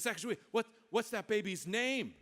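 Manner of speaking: high, eager question; excited crowd. Acted. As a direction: burst out asking, high-pitched. Crowd bursts out asking.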